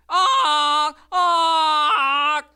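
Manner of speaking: loud, high screaming cries; screams. Acted. Lucille screaming at the gunshot. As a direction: scream in shock.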